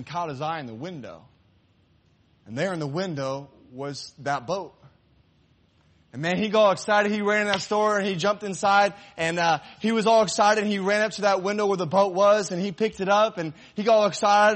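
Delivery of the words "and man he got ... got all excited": high, loud, thrilled narration; excited storytelling. A narrator's reading. Preacher telling the congregation of the boy finding his boat, thrilled. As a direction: ride the boy's excitement.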